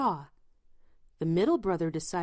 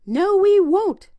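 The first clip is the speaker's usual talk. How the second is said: high, loud piglet refusal; little pigs' voice; 12 semitones higher, 10 dB louder than usual. A narrator's reading. Pigs answering the wolf from inside, high defiant shout. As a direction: shout back in a high piggy voice. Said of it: "said the pigs".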